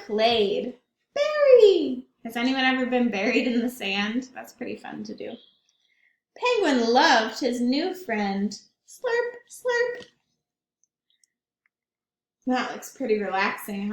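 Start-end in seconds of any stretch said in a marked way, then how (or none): 1.1-2.1 high, loud playful shout; excited cry. Acted. Playful read exclamation. 8.9-10.1 high playful slurping; slurp sounds. Acted. Sipping drink sound word.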